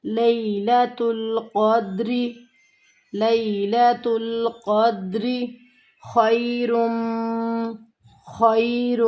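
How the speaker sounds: slow, drawn-out tajweed chanting; Quran recitation. Shown as a demonstration. Teacher chanting the verse slowly for her students. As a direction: recite each word long and measured.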